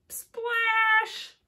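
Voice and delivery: high, loud splash; big splash. A narrator's reading. Storyteller shouting the splash for the children. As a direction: belt out the splash. Sound word read to kids.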